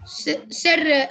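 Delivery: normal talking voice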